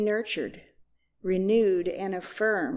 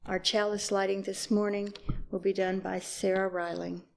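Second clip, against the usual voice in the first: ordinary talk, nothing marked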